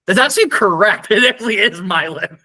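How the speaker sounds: high, loud, delighted confirmation; laughing surprise. Real, not acted. Co-host hearing the right guess, laughing surprise. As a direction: burst out amazed, breaking into a laugh.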